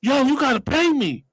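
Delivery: high-pitched acted insistence; insistent demand. Crashed passenger telling the driver, high insistent demand. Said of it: "I'm steady telling them".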